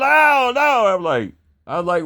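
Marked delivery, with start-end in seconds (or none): 0.0-0.9 very high yelping cry; imitated pained screaming. Billy getting stung by yellow jackets, screaming. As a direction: wail it high, as if being stung. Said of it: "He starts screaming".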